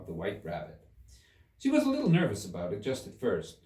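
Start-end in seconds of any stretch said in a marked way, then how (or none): none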